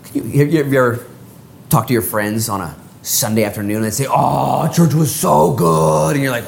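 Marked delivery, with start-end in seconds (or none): 4.1-6.2 tag gushing friend. Acted. Voices friends raving about church.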